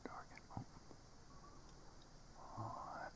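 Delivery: muttering under breath; whispering. Real, not acted. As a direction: whisper to yourself.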